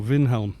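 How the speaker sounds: Vinhelm with a V in place of the W; Norwegian pronunciation. Shown as a demonstration. Streamer to chat, Vinhelm with a Norwegian V. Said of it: "W's are more like a V".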